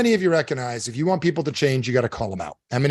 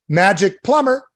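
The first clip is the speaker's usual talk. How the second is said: loud, slow, higher phone greeting; plumber answering phone; 5 semitones higher, 7 dB louder, slower than usual. Acted. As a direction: announce yourself proudly as the plumber. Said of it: "And he goes".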